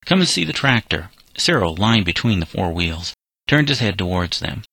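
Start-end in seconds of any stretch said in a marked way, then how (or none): 0.0-1.1 higher-pitched, mildly voiced call; a light narrated call-out. A narrator's reading. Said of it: "cried a voice that seemed to come out of the ground".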